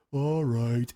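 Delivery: slow, drawn-out giving in; mock resignation. Pretending to give in and leave, mock resignation. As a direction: stretch it out like meek surrender. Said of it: "I'm not gonna go".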